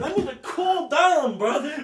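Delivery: laughing plea. Real, not acted. Protests while laughing.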